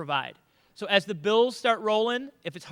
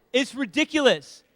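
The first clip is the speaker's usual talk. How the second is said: ordinary talk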